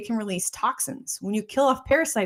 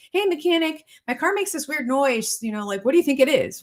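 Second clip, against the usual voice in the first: higher-pitched, naive customer question; mock customer; 5 semitones higher than usual. Car owner asking a mechanic about a noise, higher mock voice. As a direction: act out a clueless customer's request.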